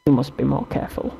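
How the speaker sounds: ordinary delivery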